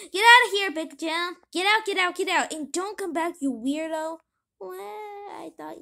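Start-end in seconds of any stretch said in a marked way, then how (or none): 0.1-4.2 loud, higher angry yelling; furious shout at the pest. Acted. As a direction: chase him out furiously. Pity throws Big Jim out.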